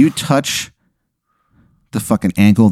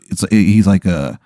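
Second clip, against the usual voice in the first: usual speaking voice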